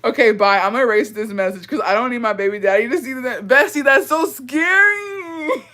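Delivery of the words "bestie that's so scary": slow, stretched words broken by laughter; laughing so hard it sounds like crying. Real, not acted. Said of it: "I'm laughing, but I'm crying".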